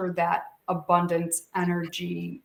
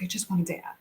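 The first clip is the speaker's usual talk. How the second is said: softly imitating a crying boy; 6 dB quieter, faster than usual. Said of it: "he's like".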